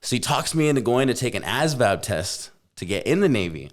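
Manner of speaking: ordinary delivery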